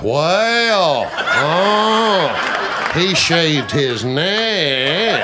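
slow, stretched North Carolina drawl; folksy North Carolina impression with long gliding vowels; Andy Griffith. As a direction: draw each word way out, North Carolina style. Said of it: "all talk like Andy Griffith".

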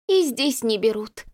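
ordinary delivery, nothing marked

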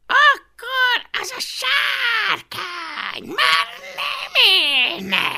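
very high, drawn-out screechy threat; Cikcakkocska's icy hiss. Acted. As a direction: threaten as an imperious tiny dragon.

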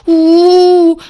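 high, loud drawn-out howl. A narrator's reading. Heroine rubbing her bruised forehead, howling. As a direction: wail "oooh" in pain. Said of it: "выла я".

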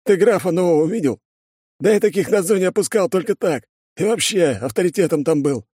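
high-pitched, cocky guard voice; boastful smoker. Acted. Smoker boasts to his mate about prison standing, cocky.

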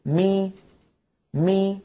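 مي with the jaw dropped. Shown as a demonstration. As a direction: drop the jaw for مي. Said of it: "انخفاض للفك السفلي".

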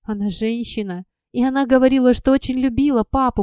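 plain reading voice

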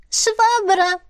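high squeaky little animal; proud, squeaky correction; pleased otter creature voice. A narrator's reading. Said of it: "довольно поправила выдра".

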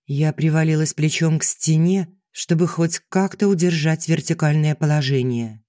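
usual speaking voice

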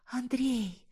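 high, hushed plea by name; frightened whisper. A narrator's reading. Dizzy, terrified woman appealing to the man, whispering. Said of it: "шепчу".

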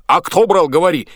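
loud, high angry demand; angry bellow. A narrator's reading. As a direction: bark at the sailor to confess. Boatswain interrogates sailor. (боцман грозно требует ответа)

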